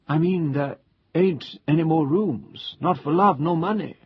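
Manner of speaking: an obliging hotel waiter gossiping to a guest; old waiter cheerfully reporting the full hotel to the guest; chatty old waiter. Acted. Waiter explains, common speech.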